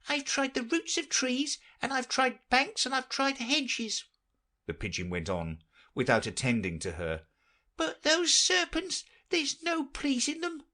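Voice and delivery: very high, fussy bird voice; fretful pigeon. Acted. The pigeon grumbling about serpents, very high fussy voice. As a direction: complain like a harried mother bird.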